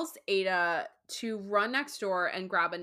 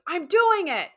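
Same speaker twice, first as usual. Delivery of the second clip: imitating snappy husband; 9 semitones higher, 9 dB louder than usual. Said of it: "he goes".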